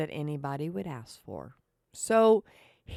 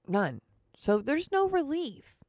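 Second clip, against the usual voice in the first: ordinary delivery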